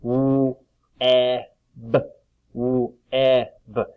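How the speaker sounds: "Woo, eh, b" as three isolated sounds of web; sounds split apart. Shown as a demonstration. Teacher splitting web for pupils, sound by sound. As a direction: sound out web slowly, a pause after each sound. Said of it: "split the sounds apart".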